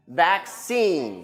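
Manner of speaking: "vaccine" stressed on the final "cine"; last-syllable stress. Shown as a demonstration. Teacher leads class practice, "vaccine" stressed last. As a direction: say "vaccine" with weight on the second syllable. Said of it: "You practice with me".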